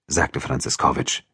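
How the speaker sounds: usual speaking voice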